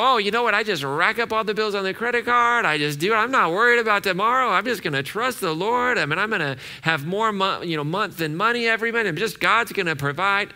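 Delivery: high, loud parody of blithe overconfidence; mock carefree believer. Preacher mimics someone trusting God with credit card bills, mock carefree. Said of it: "We don't get to go".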